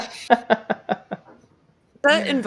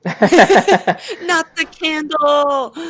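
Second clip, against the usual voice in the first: drawn-out laughing cry, higher and louder; mock outrage; 7 semitones higher, 4 dB louder, much slower than usual. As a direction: wail it in fake horror. Mock outrage over candle.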